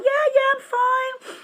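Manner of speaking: high-pitched mock reassurance that all is well; insisting she's fine. Woman reassuring her worried husband, high insistent brush-off.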